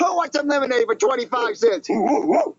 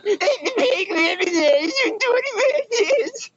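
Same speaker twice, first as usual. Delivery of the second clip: slow, higher whiny mock voice; mocking imitation; 8 semitones higher, slower than usual. Kid mocking how his rival calls customers, whiny mimicry. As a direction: parody his rival's pitch as whiny and dumb. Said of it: "just by saying".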